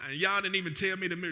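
normal talking voice

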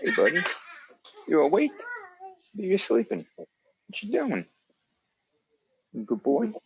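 high, soft coo at a sleepy dog; doggy talk. Real, not acted. Man cooing to his dog on the couch, soft high pet talk.

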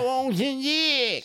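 put-on old lady voice. Imitating old woman.

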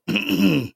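short, loud mock throat clear; imitated throat clearing. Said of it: "a guy that cleared his throat".

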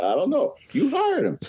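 high-pitched teasing reply; teasing retort. Retold or imitated. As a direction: toss it back playfully. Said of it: "I said".

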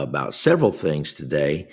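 ordinary talk, nothing marked

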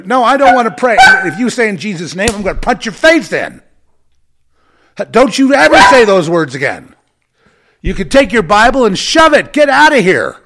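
high, loud, raging threats; angry shout. Real, not acted. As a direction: bellow him out of the room. Shouting down a praying brother.

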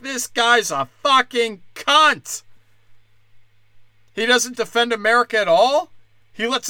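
hammed-up indignant outburst; outraged rant. Imagined outraged newscast rant.

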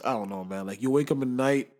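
ordinary talk, nothing marked

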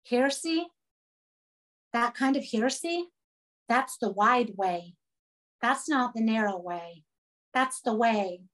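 normal talking voice

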